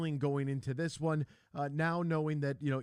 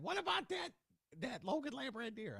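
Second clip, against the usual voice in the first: mother voice; 10 semitones higher, 6 dB quieter than usual. Retold or imitated. Host voices his mother.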